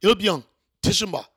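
loud, high Korean "hello?" into the phone; hammed-up gate guard bellowing the phone greeting; phone-answering routine. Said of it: "when they answered the phone".

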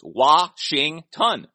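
Washington said very slowly, each syllable drawn out; slow syllable demo. Shown as a demonstration. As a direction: spell out the three syllables of Washington by ear. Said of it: "It's three syllables".

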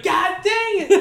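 high, loud, drawn-out cuss; mock frustrated yell. Hunter checking the app, acting out frustration.

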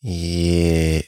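usual speaking voice

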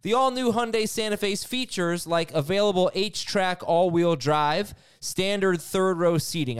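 upbeat sponsor read voice. Acted. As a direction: sell it like a radio ad. Host reads sponsor ad.

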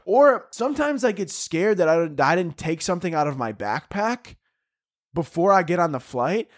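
ordinary talk

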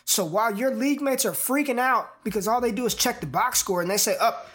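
upbeat pitch to listeners; promo read. Acted. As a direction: sell the show with energy. Promo pitch over music.

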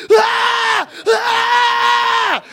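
high, loud imitated kid screams; piercing mock shriek; kids screaming. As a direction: scream like a mob of children. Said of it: "just started screaming".

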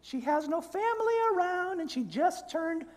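high falsetto guilt-tripping conscience; angel on the shoulder. Acted. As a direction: coo it very high, stretching the phrases. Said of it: "you hear the one voice that says".

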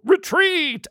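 high-pitched cry of retreat; mock battlefield yell, pitched high; soldier's call. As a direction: call the troops back in a high voice. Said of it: "you hear someone go".

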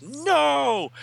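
very high sharp no; defeated villain's cry. Acted. Villain Mordru resisting the binding, high cry. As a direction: cry out as the spell takes him.

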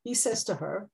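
usual speaking voice

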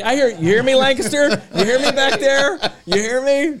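high-pitched playful holler, laughing; calling out. Pretend. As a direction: call to someone at the back, half laughing. Calling out to a distant listener.

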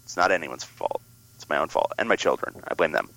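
ordinary talk

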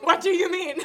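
loud, high, laughing disbelief; laughing disbelief at the director; laughing flustered question. Retold or imitated. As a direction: ask it through a flustered laugh.

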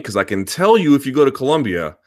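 usual speaking voice

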